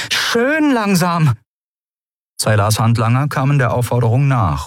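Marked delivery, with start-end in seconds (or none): 0.1-1.3 high, drawn-out warning; demanding. A narrator's reading. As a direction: stretch it out as a firm order. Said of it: "forderte Endriel". (fordernd, hoch und gedehnt)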